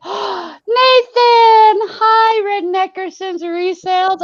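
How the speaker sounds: high, loud bright hello; excited greeting. Real, not acted. Thrilled to spot Nathan in the chat, excited hello. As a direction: greet him like you're thrilled.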